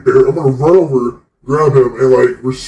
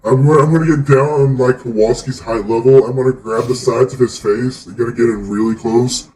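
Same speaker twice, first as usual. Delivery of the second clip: ordinary delivery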